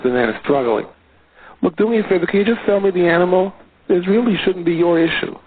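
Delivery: normal talking voice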